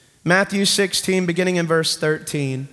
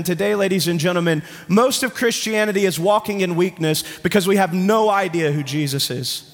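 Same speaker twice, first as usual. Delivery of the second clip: ordinary talk, nothing marked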